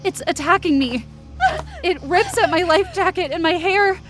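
high, urgent telling under attack; breathless-pitched alarm, voice pushed up; panicked narration. A narrator's reading.

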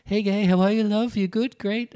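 high-pitched British accent impression; Paul McGee. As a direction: greet effusively in a high, put-on British accent. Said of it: "Can you do it in his accent?".

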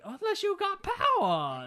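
mock gang member. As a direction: stretch it like a crazy goon. Quoting a Griff gang member.

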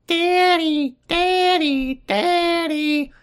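put-on very high, squeaky child voice; put-on tiny voice calling for a parent; little girl Megan. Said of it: "I hear Megan whisper".

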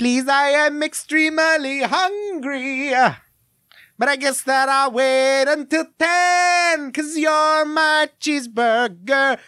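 very high, very slow squeaky little gourd voice; Mister Lunt voice. Retold or imitated. Quoting the VeggieTales commentary to co-host, high slow Mister Lunt voice. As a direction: stretch every word up high like a tiny Italian gourd. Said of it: "I can't blow out my voice here".

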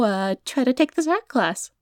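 ordinary talk, nothing marked

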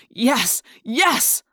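exultant yes, rising on the repeat; half-yelled triumph; mild yell. A narrator's reading. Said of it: "I wanted to yell".

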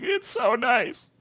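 high, soft, drawn-out near-weepy voice; mock-tearful squeak, slow and small; holding back tears. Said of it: "holding back tears".

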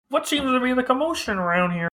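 put-on high first try at an old man; a bad first stab at an old voice; failed old man voice. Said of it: "That's not the good voice".

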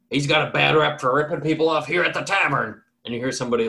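usual speaking voice

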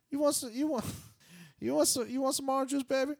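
high, loud, doting voice; imitating the room service lady. Retelling the hotel lady offering breakfast, high and doting. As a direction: coo at him like a fussy auntie. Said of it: "This woman definitely sounds like she's Korean".

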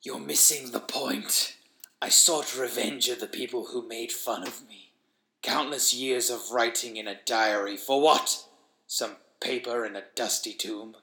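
hushed monster rasp, fading to a whisper; bitter wheezing creature. Acted. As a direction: confess revenge in a soft, labored croak. Creature explains its revenge.

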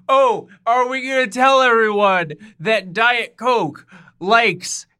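high, loud mock outrage; mock scandal. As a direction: drag out the scandalous reveal.

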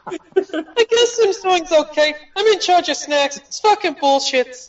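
hammed-up high squeak; squeaky voice. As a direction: whine about the snacks in a squeak. Joining the silly voices game.

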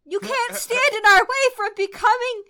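indignant outburst. Acted. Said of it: "Willow runs up and says".

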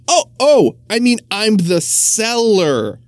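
high, stammering excuse; flustered butcher. Acted. Butcher scrambling to cover his slip, high and flustered. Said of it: "explained the butcher quickly".

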